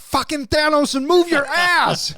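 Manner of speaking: shouting. Real, not acted. Joking threat, shouting.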